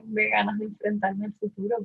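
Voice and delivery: ordinary delivery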